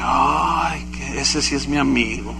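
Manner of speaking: soft, low retold sigh; hushed, low muttered reaction; quiet aside. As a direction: murmur it to yourself in dismay. Retold dismayed aside about friend.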